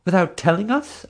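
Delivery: high, shocked outcry; crying out. A narrator's reading. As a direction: cry out in dismay. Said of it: "a mother cried".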